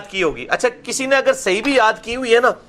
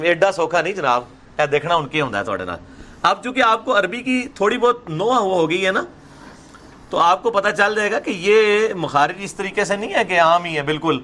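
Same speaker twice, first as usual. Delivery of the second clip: normal talking voice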